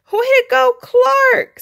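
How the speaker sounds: high, loud friendly cheer. Acted. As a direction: cheer him on.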